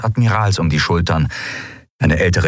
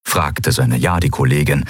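plain reading voice

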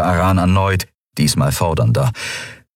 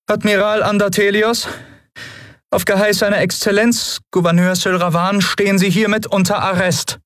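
much higher, formal officer's voice; female lieutenant voice. Acted. Lieutenant announcing herself to the admiral, in a high formal officer voice.

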